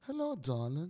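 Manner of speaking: flirty put-on British accent; English woman's accent. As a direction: greet like an Englishwoman, British vowels. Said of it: "El acento inglés en la mujer". (acento inglés de mujer, imitado)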